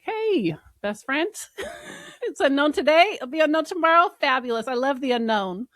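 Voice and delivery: high, loud, playful delight; gushing enthusiasm. Acted. Greeting the unknown as a best friend, hammed-up excitement.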